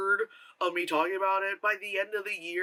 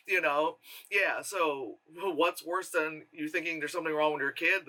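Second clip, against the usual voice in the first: usual speaking voice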